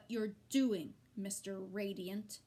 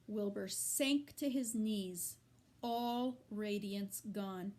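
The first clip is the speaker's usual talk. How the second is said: plain reading voice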